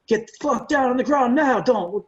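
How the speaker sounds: retold gunman's order. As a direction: bark it like an imitated armed robber. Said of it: "ordered him to the ground and said".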